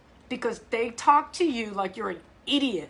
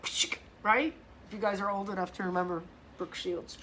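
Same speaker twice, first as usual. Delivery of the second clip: usual speaking voice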